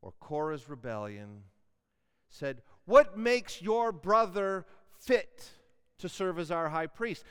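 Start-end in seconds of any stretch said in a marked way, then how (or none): 2.8-7.2 defiant challenge. Acted. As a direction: throw the question at Moses like a rebel. Korah challenges Moses.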